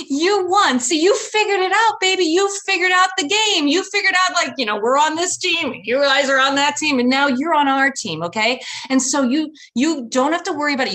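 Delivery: high, loud fake cheer; forced enthusiasm. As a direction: sell it with big enthusiasm. Mom spinning the story excitedly.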